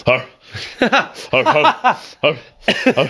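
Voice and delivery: high mock dog barks; barking like a dog. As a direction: bark like a dog hitting smelling salts. [name] barks when he's doing it.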